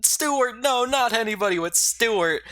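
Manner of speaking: high, loud pleading no; despairing high-pitched protest; distraught cry. Retold or imitated. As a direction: beg the game to undo it, voice cracking high. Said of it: "And I'm like".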